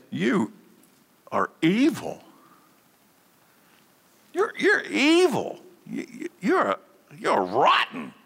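lightly voiced king, high and strained on "evil"; a hint of a furious king scolding his servant; angry king. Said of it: "and said".

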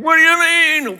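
high, loud angry yell; exasperated dad. Retold or imitated. Dad grabbing his lost son, mock furious yell. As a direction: shout it like a furious father. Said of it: "as the dad, I'm going".

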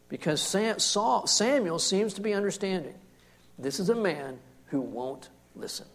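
usual speaking voice

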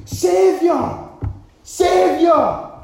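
high, loud pleading shout. Acted. Preacher voicing lepers calling to Jesus, high pleading shout. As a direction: beg across the distance. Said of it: "And then they say".

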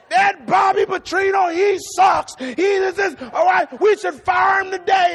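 loud, high angry rant; fussing hard. Retold or imitated. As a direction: re-enact an enraged fan yelling at the coach. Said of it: "I was fussing hard".

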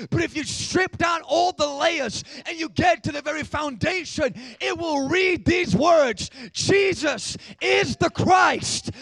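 high, loud, building preaching shout; full-force preaching. Acted. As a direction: build to a roaring climax.

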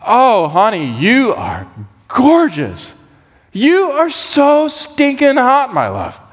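high, slow, swooning lover's gush; hammed-up besotted husband. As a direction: lay the flattery on thick. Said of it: "that was the Message version".

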